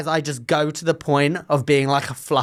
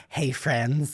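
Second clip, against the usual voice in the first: deadpan greeting; 5 semitones lower, 7 dB quieter than usual. Retold or imitated. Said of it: "whenever I'm like".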